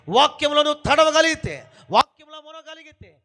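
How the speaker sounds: loud, driving sermon delivery; full-force preacher pounding every line; preaching at full force. Acted. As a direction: preach it out hard to the congregation.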